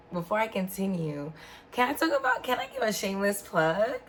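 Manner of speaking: usual speaking voice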